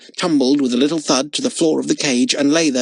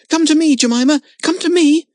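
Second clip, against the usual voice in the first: high, louder calling out; 7 dB louder than usual. A narrator's reading. Girl calling her frightened monkey to come back. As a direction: call the frightened monkey to you. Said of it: "called Lotta".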